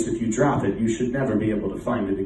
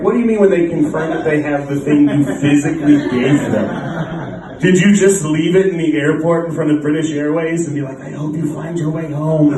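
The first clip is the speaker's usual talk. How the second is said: louder, incredulous retold complaint; exasperated disbelief; 3 semitones higher, 5 dB louder than usual. Passenger questioning airline about his lost bags, exasperated and incredulous. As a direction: challenge them with mounting disbelief.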